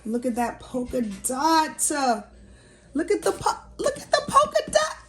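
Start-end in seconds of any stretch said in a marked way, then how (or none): 2.9-5.0 squealing through a laugh, fast; high excited squeal. Real, not acted. Vlogger showing viewers the polka dots, giddy squeal. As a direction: let it burst out high and giddy.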